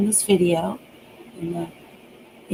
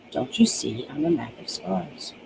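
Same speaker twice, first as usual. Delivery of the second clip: much softer, hollow repeated line; empty, cold voice; 3 semitones lower, 7 dB quieter than usual. Acted. The patient answers every question the same way, hollow and soft. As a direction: say it like a recording playing back. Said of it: "Her voice sounded empty, cold, raspy".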